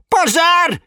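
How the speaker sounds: high, loud alarm cry; shout. A narrator's reading. Ficus plant yelling fire at the waking house, shouting. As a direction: raise the alarm at full voice. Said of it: "закричал фикус Валентин".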